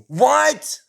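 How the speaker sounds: high, indignant 'what'; shocked outburst. Acted. Squidward reacts to Jalen's offense, shocked and indignant. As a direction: burst out high in disbelief.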